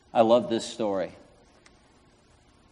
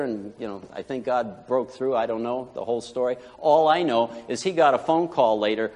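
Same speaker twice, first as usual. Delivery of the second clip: ordinary talk, nothing marked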